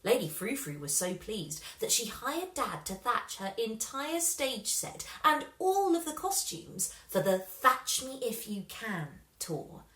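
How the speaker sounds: ordinary delivery, nothing marked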